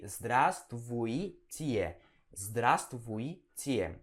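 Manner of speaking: "Здравствуйте" built up slowly from "Zdra"; syllable-by-syllable demo. Shown as a demonstration. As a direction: break "Здравствуйте" into slow pieces. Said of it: "a pronúncia é assim". (soletrando devagar, sílaba por sílaba)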